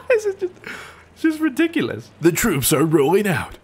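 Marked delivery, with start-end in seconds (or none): 0.1-2.1 high-pitched words through real laughter; laughing. Real, not acted. Gamer amused by bicycle charge, laughing. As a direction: break up laughing while speaking. Cracking up at own act.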